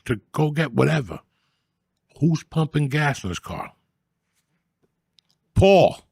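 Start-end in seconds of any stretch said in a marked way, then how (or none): 5.5-6.0 higher and louder, a shocked name drop; big reveal. Retold or imitated. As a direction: punch the name like a twist ending. Shocking reveal at the gas station.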